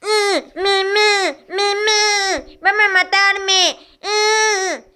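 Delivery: high, whimpering bleats; crying lamb. Acted. The lamb fearing Sid will kill her, crying. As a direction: bleat tearfully like a scared lamb.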